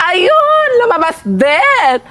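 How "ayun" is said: triumphant cry. Acted.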